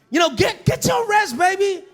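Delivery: sugary fake sweetness. Fake-sweet talk to wife.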